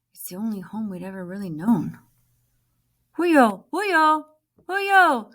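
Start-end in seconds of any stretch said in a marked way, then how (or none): 3.2-5.3 loud rising calls, each one higher; calling out loudly. Acted. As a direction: shout it across the camp, again and again. Boy calling out repeatedly.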